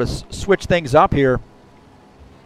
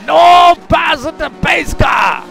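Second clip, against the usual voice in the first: hammed-up driver yelling, much higher and louder; Sullivan impression; 14 semitones higher, 6 dB louder, much slower than usual. Commentator mimics a fellow racer for his viewers, hammed-up high shout. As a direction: shout it high and loud like a mock radio call. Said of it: "so I'm gonna do my Sullivan impression".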